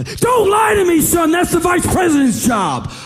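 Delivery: high, loud scolding shout; yelling. Retold or imitated. Scolding the boy for lying, high loud shout. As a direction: roar it like a drill sergeant.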